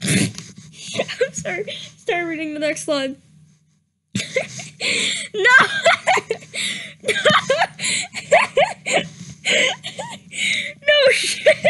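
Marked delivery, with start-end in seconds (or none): 0.0-1.7 whispered sorry through a held-in giggle; can't keep the laugh down while apologizing; laughing apology. Real, not acted. Reader cracks up at the text. 4.2-11.6 laughing so hard it turns to tears; helpless laughter. Real, not acted. Reader in fits over a typo.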